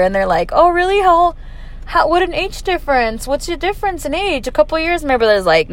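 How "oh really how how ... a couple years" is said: high-pitched mock astonishment; retold surprise, voice pitched way up; surprised coworkers. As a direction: imitate nosy coworkers taken aback.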